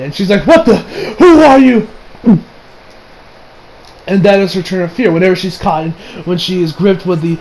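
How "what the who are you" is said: high, drawn-out alarmed question; mock startled cry. Mom startled by the man beside her, mock alarm. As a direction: act out a jolt of alarm at a stranger.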